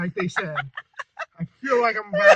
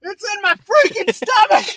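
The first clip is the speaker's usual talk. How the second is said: pained shout; 10 semitones higher, 6 dB louder than usual. Real, not acted. Burning stomach, shouting.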